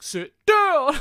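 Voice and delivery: high loud bark; shouted command. Retold or imitated. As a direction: snap the order at them. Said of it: "he's like".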